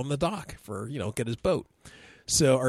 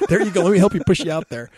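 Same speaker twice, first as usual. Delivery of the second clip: higher, louder, quick cheerful push-off; cheery push-off; 6 semitones higher, 8 dB louder, faster than usual. Retold or imitated. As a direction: send the kid off eagerly. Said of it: "And Pete's like".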